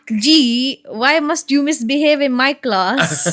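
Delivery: slow, scolding teacher; mock a reproachful teacher's measured scolding; teacher imitation. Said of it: "teacher, she used to say".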